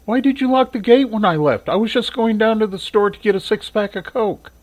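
mother questions her son about the gate, high and peeved; irritated mother. Acted. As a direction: scold him for locking her out. Mother's character voice.

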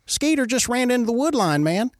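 high-pitched put-on voice for the interpreter; interpreter's voice, no British accent. Interpreter telling Alex what he saw, high American voice. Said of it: "And then the Terp's like".

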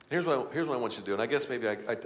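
normal talking voice

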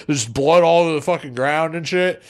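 ordinary talk

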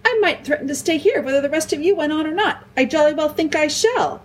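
high, fast, sarcastic mimicry of a little sister; mock her sister's stubbornness; mocking Lucy. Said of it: "Suppose I started behaving like Lucy".

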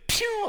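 quick shooting-away effect; zooming-off noise. Retold or imitated. Preacher showing sin seizing its chance, imitated zoom.

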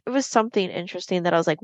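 ordinary delivery

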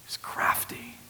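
hushed, unvoiced aside; soft, conspiratorial whisper; crafty whisper. Shown as a demonstration. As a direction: whisper it slyly. Evoking the crafty serpent.

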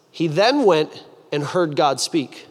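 normal talking voice

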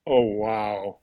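low drawn-out wail; imitated sampled scream. Drummer replaying his sampled scream, low imitation. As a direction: mimic a scream sampled on a synthesizer. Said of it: "He sampled".

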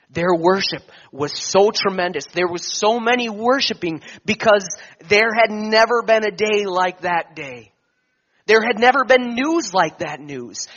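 swelling sermon climax; rising preaching fervor. Acted. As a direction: preach it at full force, slowing on the peak. Preacher builds on worship.

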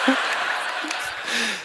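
a high, soundless laugh; laughing at his own cockiness; breathy laugh. Real, not acted. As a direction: chuckle under the breath.

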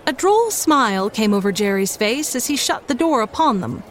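ordinary delivery, nothing marked

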